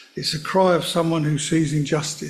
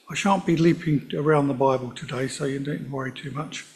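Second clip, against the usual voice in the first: ordinary delivery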